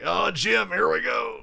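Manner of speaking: high, loud, excited commentator catchphrase; hyped-up sportscaster impression; Tony Romo. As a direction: launch it upward with booming eagerness. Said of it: "He's always like".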